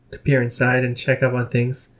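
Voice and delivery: usual speaking voice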